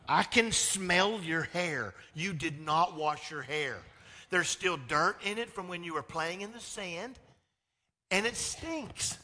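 firm mock accusation; mom confronting. Mom confronting her lying son.

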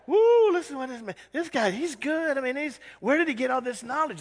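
hammed-up scoffing townsfolk; mocking scoff. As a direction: ham up the crowd's fake awe. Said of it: "They scoffed".

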